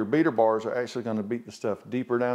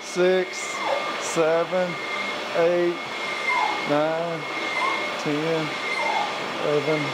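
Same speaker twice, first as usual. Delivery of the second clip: counting strokes aloud, a bit louder; 3 semitones higher, slower than usual. Shown as a demonstration. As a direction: call out each count, raised and steady. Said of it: "so I'm gonna count it out for you".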